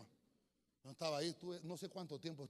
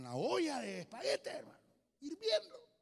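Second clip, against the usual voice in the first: rising much higher and louder to hirviendo; alarmed build-up; 11 semitones higher, 8 dB louder than usual. Retold or imitated. Preacher reliving the boiling pot falling, rising to a high cry.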